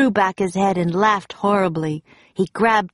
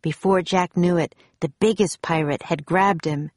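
ordinary delivery

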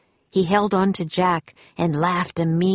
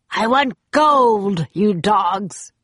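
slow, loud pirate demand; angry pirate captain; 3 semitones higher, 4 dB louder, slower than usual. A narrator's reading. Captain Bones berates his crew, demanding loudly. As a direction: demand it slowly, raised at first.

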